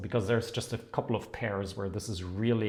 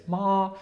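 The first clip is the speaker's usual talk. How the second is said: MA held on a higher tone; syllable melody; 8 semitones higher, 4 dB louder than usual. Shown as a demonstration. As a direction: say ma up high as a contrasting tone. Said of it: "depending on the melody".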